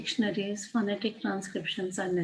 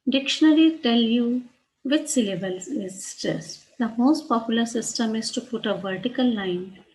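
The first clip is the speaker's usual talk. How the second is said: usual speaking voice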